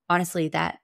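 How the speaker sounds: ordinary talk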